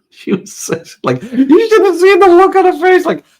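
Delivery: high, loud laughing outburst; laughing hard while telling his friend about her face. Real, not acted. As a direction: crack up as you say it.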